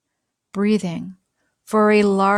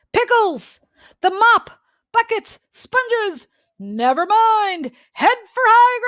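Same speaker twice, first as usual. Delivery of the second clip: much higher, loud frantic cries; panicky scream; 12 semitones higher, 5 dB louder than usual. Acted. Pete panicking as the house floods, screaming. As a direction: shout the orders in a panic. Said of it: "a panicky Pete screamed".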